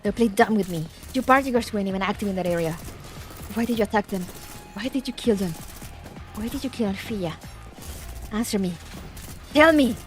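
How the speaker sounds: angry dub voice. Acted. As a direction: read the lines like an angry anime villain. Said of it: "the Japanese V A did".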